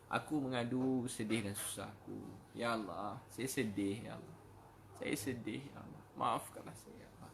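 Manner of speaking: hushed, sorrowful pleading, fading away; tearful prayer. Acted. Preacher voices Umar pleading with Allah, soft acted tearful prayer. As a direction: plead softly to God as if weeping. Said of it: "pernah menangis doa".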